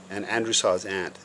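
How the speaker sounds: plain reading voice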